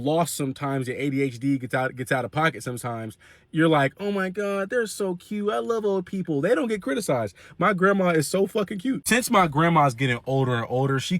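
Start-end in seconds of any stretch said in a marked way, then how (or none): 4.0-7.3 mock gushing. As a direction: lightly mimic someone cooing over the elderly. Imitating people gushing over old folks.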